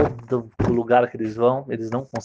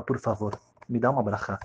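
softer, lower plea; humble request; 4 semitones lower, 8 dB quieter than usual. Retold or imitated. Husband quietly asking the man for a blessing.

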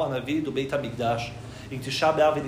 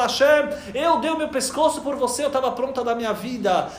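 pleading patriarch; 7 semitones higher than usual. Acted. Yitzhak pleads to Hashem.

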